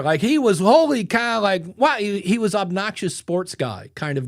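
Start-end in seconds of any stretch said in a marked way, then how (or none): 0.6-2.0 pitched up and louder, a mock announcer; hammed-up radio guy exclamation; over-eager mic voice. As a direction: imitate a client going way up into mic mode. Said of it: "he was way up here".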